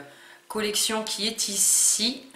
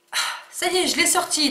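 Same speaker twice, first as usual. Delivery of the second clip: relieved; 10 semitones higher, 4 dB louder, faster than usual. Real, not acted. Relief after unwrapping the box.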